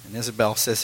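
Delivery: ordinary talk, nothing marked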